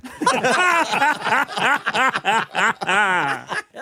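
rising laugh at own punchline; long hearty laugh. Real, not acted. Punchline of the story.

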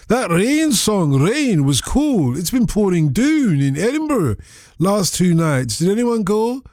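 slow, drawn-out Scottish accent reading a listener's text. Shown as a demonstration. Radio host reads an Edinburgh listener's text aloud in a Scottish accent. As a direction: read it like a Scot from Edinburgh, stretching the words. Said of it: "I've got to do it in the accent".

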